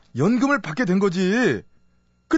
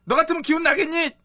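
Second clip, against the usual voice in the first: higher-pitched comic indignation; indignant outburst; 6 semitones higher than usual. Acted. Fugitive rebuking servant who said cheer up, mock indignant. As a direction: lash out at the sympathy, hammed-up. Snaps at being told cheer up.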